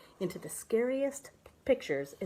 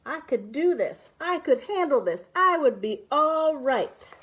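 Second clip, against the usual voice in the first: building courage; 6 semitones higher, 6 dB louder than usual. Acted. Pep talk to self, building.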